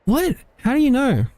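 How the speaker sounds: high, chirpy companion voice; squeaky little helper, cheering up; high Paimon voice. Acted.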